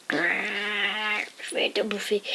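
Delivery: voix de dragon très lente et plus douce; dragon menaçant. Acted. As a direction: grogne la menace lentement comme un monstre. Le dragon gueule ouverte. (le dragon menace de dévorer, voix lente et sourde)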